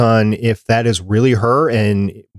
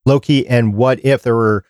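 usual speaking voice

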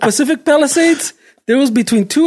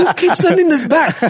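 laughing mock complaint; 6 semitones higher than usual. Mocking coworker while laughing.

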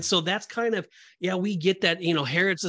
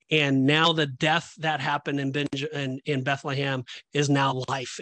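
normal talking voice

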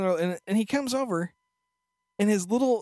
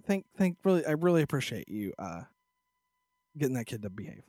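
soft thank-you; 7 semitones lower, 6 dB quieter than usual. Retold or imitated. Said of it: "And says".

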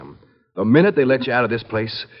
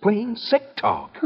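hushed reproach; 2 semitones lower, 7 dB quieter, slower than usual. Acted. Brother hushing sister's fear.

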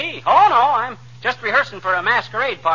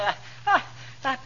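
high stuttering gasps before a sneeze; halting pre-sneeze gasps, pitched high; sneeze build-up; 10 semitones higher, 7 dB quieter, faster than usual. Acted. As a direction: catch on each I as a sneeze builds. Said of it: "sniffling and sneezing".

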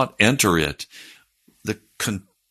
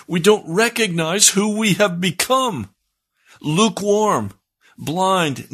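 higher, building sermon delivery, pressing each list word; impassioned preaching; 8 semitones higher than usual. Acted. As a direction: preach it with rising urgency to the congregation. Preaching builds to full force.

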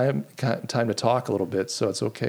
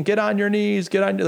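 higher-pitched imitation of a shouted command; retold police screaming; 6 semitones higher than usual. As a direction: imitate officers screaming orders, held back. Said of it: "they're screaming".

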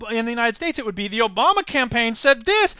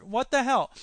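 usual speaking voice

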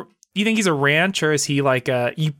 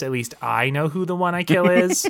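normal talking voice

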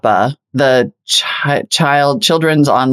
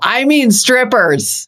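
high, drawn-out teasing quote; mocking co-host imitation; 8 semitones higher, much slower than usual. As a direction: mimic him high and slow, hamming it up. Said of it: "Jake Al's all".

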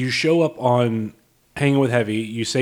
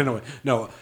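ordinary delivery

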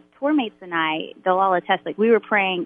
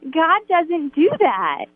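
slow, much higher, drawn out with nervous awkwardness; awkward nervous refusal; 9 semitones higher, much slower than usual. Retold or imitated. Tori turning down the young man's prayer request, slow and nervously high. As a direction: stretch it out, pitched high, laughing it off nervously.

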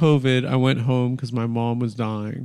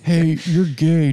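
mock prank caller; 4 semitones higher, 3 dB louder, slower than usual. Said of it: "prank caller bit".

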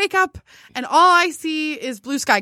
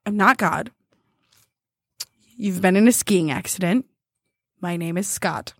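very calm; 7 semitones lower than usual. Retold or imitated. Older ski patroller to confused injured skier, very calm. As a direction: calmly set someone straight, pitched down. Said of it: "like, very calmly, very calmly".

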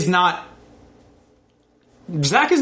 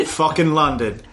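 ordinary talk

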